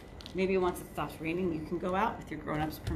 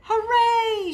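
much higher, much louder cheer; shouted hooray; 14 semitones higher, 9 dB louder than usual. Acted. The friends cheering that they can play outside, loud high cheer. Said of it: "shouted the others".

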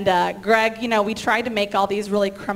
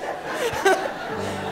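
breathy laugh; 12 semitones higher, 4 dB quieter than usual. Real, not acted. Laughing at her own bit.

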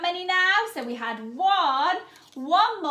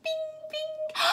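high soft pretend switch-on bing; high bing sound; 11 semitones higher, 7 dB quieter than usual. Teacher switching on children's listening ears with a high bing. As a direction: chime it like a little switch. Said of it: "Switch your listening ears on".

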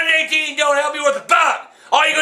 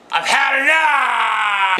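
anguished yelling cry, slow and drawn out; crying shout; much slower than usual. Real, not acted. Old man to family, fed up and crying out. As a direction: wail it out, fed up and hurting.

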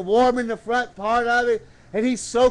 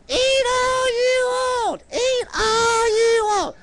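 very high, slow puppet squeak; 12 semitones higher, slower than usual. Pretend. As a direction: stretch each word in a high puppet voice. Puppet answers what she said.

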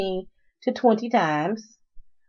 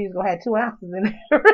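ordinary talk

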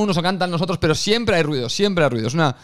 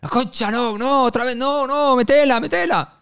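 higher, pleading Argentine groan at a missed chance; frustrated Argentine friend; 9 semitones higher, slower than usual. Retold or imitated. Friend groaning at a missed shot, frustrated Argentine accent. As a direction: beg the ball into the net, Argentine style. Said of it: "Falla en una bola y dice".

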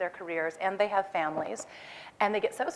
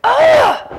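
loud, high cry of frustration; frustrated cry; 17 dB louder than usual. Retold or imitated. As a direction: let out a sharp, helpless shout. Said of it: "I just went".

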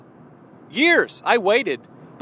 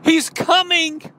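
higher, louder call, slow on he's coming; 7 semitones higher, 5 dB louder than usual. Acted. Virgin calling to her friend Martha to get up, excited call. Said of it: "she calls her friend and she says".

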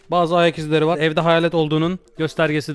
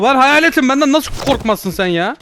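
exasperated shouting; 8 semitones higher, 5 dB louder than usual. Pretend. Ghost demanding the old man fear him, exasperated shout. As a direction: yell it in disbelief.

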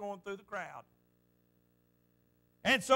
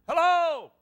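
loud call into the dark; a lost man calling for someone in the dark woods; hollering out; 5 semitones higher, 8 dB louder than usual. Retold or imitated. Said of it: "You holler out".

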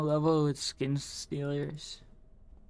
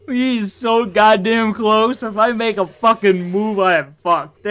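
panicking gamer; 6 semitones higher, 9 dB louder than usual. Real, not acted. Hiding with monster close.

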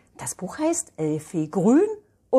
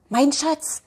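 mother's voice; 8 semitones higher, 4 dB louder than usual. Acted. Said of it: "erklärt Mama der kleinen Elfi".

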